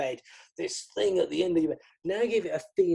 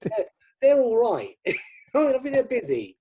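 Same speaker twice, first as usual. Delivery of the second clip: laughing sarcasm; 9 semitones higher, 6 dB louder than usual. Real, not acted. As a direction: tease them with a laugh. Teasing about famous magicians.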